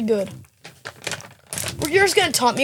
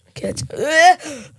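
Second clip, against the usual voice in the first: scared groan; 3 semitones higher, much slower than usual. Real, not acted. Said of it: "I'm scared".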